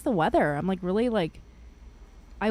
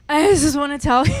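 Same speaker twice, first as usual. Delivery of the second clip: loud, higher mock-drunk voice; drunk woman; 6 semitones higher, 9 dB louder than usual. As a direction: imitate a tipsy fan blurting it out. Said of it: "she was drunk".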